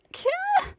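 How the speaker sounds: sharp high yelp of pain; pained cry. Acted. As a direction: cry out as the blade cuts. Struck by knife.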